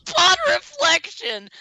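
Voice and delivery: mock the pompous phrase. Mocking the candidate's apology.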